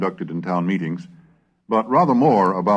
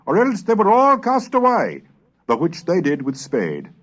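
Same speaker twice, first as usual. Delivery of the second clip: put-on measured, higher old-document reading voice; chronicle reading; 8 semitones higher, 3 dB louder than usual. Narrator reads Pilgrim chronicle to listeners, measured formal reading. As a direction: read the old chronicle aloud with gravity.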